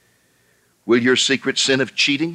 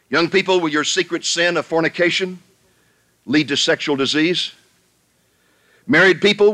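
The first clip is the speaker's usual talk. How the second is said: full-force preaching; 7 semitones higher than usual. Acted. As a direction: hammer each sin home like a revival preacher.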